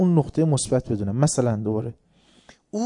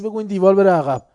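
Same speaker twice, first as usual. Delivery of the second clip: defiant challenge; 5 semitones higher, 8 dB louder, faster than usual. Retold or imitated. Soldier challenging the shouting officer.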